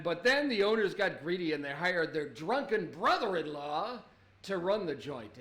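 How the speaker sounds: normal talking voice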